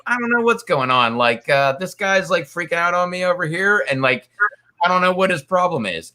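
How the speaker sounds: mock innocent Bill. Bill playing innocent to the captain, mock innocent voice. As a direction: play dumb, all innocence.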